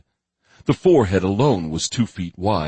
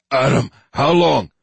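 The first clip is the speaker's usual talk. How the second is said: raised urgent call; 7 semitones higher, 6 dB louder than usual. Acted. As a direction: shout the question across. Brian calls out to Adam.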